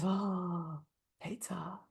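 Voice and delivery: very soft, sighed "oh, pizza"; hushed, dreamy giving-in; blissful surrender. Retold or imitated. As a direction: imitate helplessly melting at the thought. Client gives in to pizza, retold.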